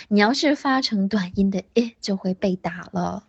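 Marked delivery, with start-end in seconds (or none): none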